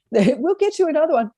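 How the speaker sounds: high, coaxing parent voice; a parent buying off a tantrum; mock pleading parent. Parent soothing a tantrum kid, high and coaxing. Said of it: "feed the tantrum".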